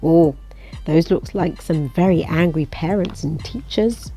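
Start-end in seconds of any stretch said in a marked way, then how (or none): none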